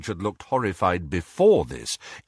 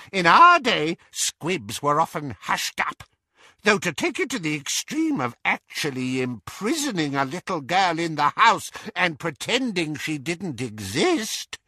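higher-pitched, emphatic aunt; opinionated aunt voice, pitched up; Auntie Muriel, gossiping; 8 semitones higher than usual. A narrator's reading. Auntie Muriel explaining squibs to Harry, high and gossipy.

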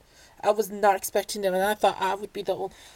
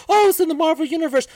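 mocking; 7 semitones higher, 5 dB louder, faster than usual. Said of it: "proceeded to talk about".

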